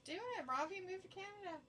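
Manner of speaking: sing-song mock encouragement. Urging Robbie to move north, high teasing voice. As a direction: egg him on, high and teasing.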